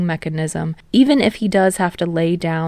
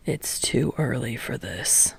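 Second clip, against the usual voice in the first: low, much softer tired voice; weary teacher; 8 semitones lower, 11 dB quieter than usual. A narrator's reading. Exhausted teacher fed up with class. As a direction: sigh it out low and worn.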